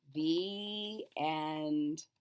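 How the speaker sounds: usual speaking voice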